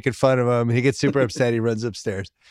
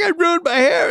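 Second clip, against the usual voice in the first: much higher, louder mock crying wail; hammed-up kid wail; 3 dB louder than usual. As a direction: exaggerate the boy's whine to a squeal. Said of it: "super upset".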